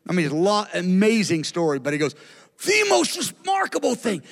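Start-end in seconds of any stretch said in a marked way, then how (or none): 2.7-4.2 high, emphatic quote of an amazed pastor; retold amazement. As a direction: marvel at it, pitched well up.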